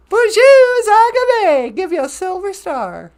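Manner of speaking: squeaky puppet greeting. Pretend. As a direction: greet like an excited little puppet. High greeting voice for viewer.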